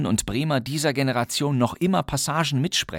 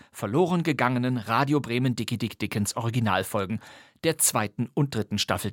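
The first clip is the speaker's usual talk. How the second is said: ordinary talk, nothing marked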